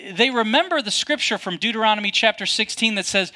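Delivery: ordinary talk, nothing marked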